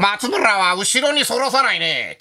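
slow, drawn-out commentator impression; Pancho Ito. Comedian retelling praise at a celebrity game, imitating Pancho Ito's commentary. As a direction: raise the pitch and stretch it like a baseball commentator. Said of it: "パンチョ伊藤さんが".

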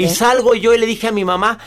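ordinary talk, nothing marked

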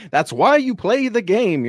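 booming sideline coach voice, slowed down; Herm Edwards impression. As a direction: preach it like a fired-up NFL coach. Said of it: "You're inner, inner Herm Edwards".

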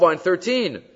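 usual speaking voice

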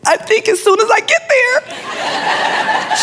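very high, slow comic squeal; hammed-up choked-up falsetto; squeaky tearful reply. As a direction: squeeze the words out as if crying. Tearful reply to regal woman.

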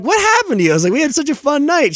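very high, incredulous retold question; acted bewilderment. Retells his puzzled morning-after text.

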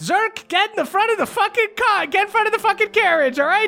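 high, hurried mother in a panic; frantic mother. Acted. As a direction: rush the words out like a mother fleeing town. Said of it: "And she goes".

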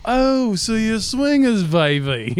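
high slow mock groovy drawl; Austin Powers. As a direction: lay it on like a sixties playboy. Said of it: "Austin Powered them".

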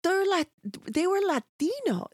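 put-on child questioning his mother; son's voice. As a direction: say it like the confused kid. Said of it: "He's like".

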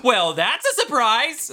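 loud, high, drawn-out earnest surprise; sincere surprise. Acted. Host imitating her learning of his lie, sincere surprise. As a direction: say it slowly and loudly, in total earnest. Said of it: "she's a hundred percent ... sincere".

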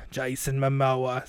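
very slow put-on character voice; slow deli man impersonation; Jason Momoa. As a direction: drag the name out in character. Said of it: "It's me, Jason Momoa".

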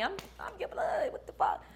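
breathy whisper acting out giving up. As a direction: whisper it like a quitter.

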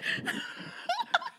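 high squealing laughter; shrieking laugh. Real, not acted. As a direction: burst out shrieking. Laughing hard.

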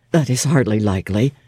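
put-on stern young clergyman judging a stranger, curt and low; terse clergyman. A narrator's reading. St. John voiced by narrator.

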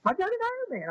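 imitating the doctor insisting, hammed up; proud doctor. Doctor insists to the patient he did an Ironman, hammed-up high boast. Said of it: "And he goes".

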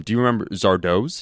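usual speaking voice

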